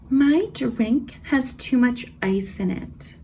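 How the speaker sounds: high, very slow, nasal-free whine "my drink has too much ice"; whiny customer mimic. Customer complaining to the server about ice, slow and high. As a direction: drag it out like an entitled complainer. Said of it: "She was like".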